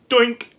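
sudden high, loud "doink"; comic boing of a breaking string; imitated string snap. Telling viewers about the string snapping, a sudden high, loud imitated snap.

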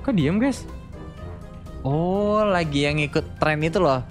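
normal talking voice

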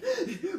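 high, sudden sobbing intake of breath; panicked gasp. Shown as a demonstration. Woman ringing her partner in panic, high gasp. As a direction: mimic hyperventilating mid-sentence. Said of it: "And I'll get like that".

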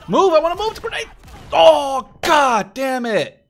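high, loud panicked shouts; panicked yelling. Real, not acted. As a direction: yell in alarm as the grenade lands. Grenade lands, panicked yelling.

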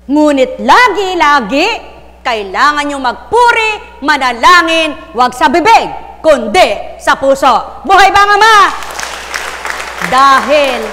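loud, punched-out phrases with pauses; emphatic shout from the pulpit; shouted preaching. Real, not acted. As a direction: preach it at full volume, phrase by phrase.

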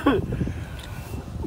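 quiet laugh under breath; soft breathy laugh. Real, not acted. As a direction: laugh softly to yourself. Toddler surprises dad.

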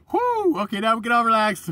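loud, high whoo of relief; relieved cheer. Retold or imitated. Vlogger acting a room relieved when he enters, loud cheer. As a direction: cheer like the boss just arrived. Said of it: "people are like".

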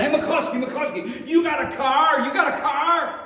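high, loud calling out across the lot; eager hollering at a buddy; excited shout. Retold or imitated. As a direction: yell it out to get his attention.

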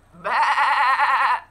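loud bleating baa; hammed-up sheep baa. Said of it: "said Sheep".

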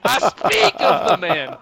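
slow, loud, grandiose announcement; grand proclamation. Pretend. Dramatic proclamation about player.

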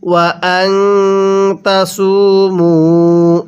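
slow chant of ফাহুয়া Khairullah; Quran recitation. Shown as a demonstration. Teacher recites for students, slow melodic chant. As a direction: draw out ফাহুয়া Khairullah with a held ল. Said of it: "কিভাবে পড়ব?".

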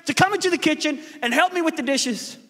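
high, exasperated Martha pleading with Jesus; complaining Martha. Acted. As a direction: squeal it like a put-upon sister. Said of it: "And she says".

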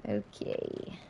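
a whispered, unvoiced okay; quiet okay. Real, not acted. Gamer calming herself after a fail, whispered okay. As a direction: breathe the word out.

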